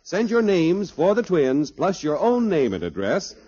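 ordinary talk, nothing marked

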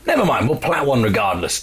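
normal talking voice

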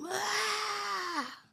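long high-pitched scream, held back in volume; imitated scream. Imitating the girl who screamed for no reason, high scream. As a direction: mimic a sudden sustained scream. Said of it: "she just screamed".

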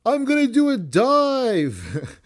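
high, slow mock yell; hammed-up ringside yell; imitating a yell. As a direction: stretch it out like a cocky wrestler announcing his move. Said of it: "and then Tony yells".

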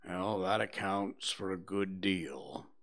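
low, quiet, resigned donkey; gloomily. Acted. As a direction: accept the bad news with a heavy heart. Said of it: "said Eeyore gloomily".

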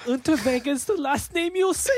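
high-pitched, hammed-up German threat; mock German accent. Co-host as killer threatening a victim, high mock German accent.

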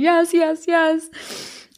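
high-pitched mock-tearful acceptance; imitated crying. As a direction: squeak it out, acting overcome with tears. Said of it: "crying, saying".

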